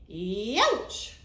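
loud wolf yelp of pain. Acted. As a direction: yelp as if scalded. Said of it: "cried the wolf".

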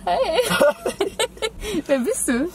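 usual speaking voice

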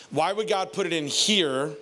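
ordinary talk

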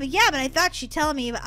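normal talking voice

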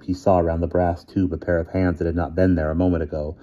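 usual speaking voice

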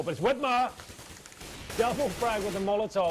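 loud, high hype scream; excited shout. Real, not acted. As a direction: erupt for the double kill. Hype call on double kill.

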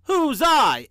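big stern engineer voice; biggest, sternest voice. A narrator's reading. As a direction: challenge it like an engineer suspecting a joke. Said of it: "He spoke in his biggest, sternest voice".

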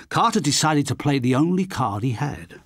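plain reading voice

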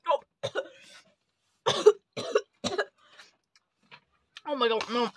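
coughing and gagging; choking on a bad taste; after bitter medicine. Real, not acted. Coughing after tasting grass jelly bean.

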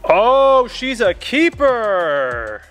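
high burst on oh, then a slow drawn-out keeper; hamming up landing a big fish; mock excited. Mock fishing triumph.

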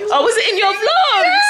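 laughing loud and high through the words; bursting into laughter mid-question. Real, not acted. Teasing her friend about the vlogs, laughing loudly.

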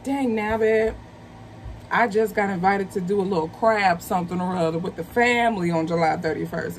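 normal talking voice

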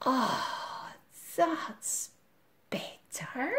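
soft satisfied whisper; hushed contented whisper. Acted. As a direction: breathe it out, full and content.

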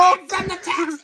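high, loud, slow indignant yell; indignant shout. Acted. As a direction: yell it out, outraged.

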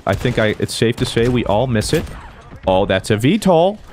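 ordinary delivery

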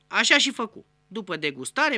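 ordinary talk

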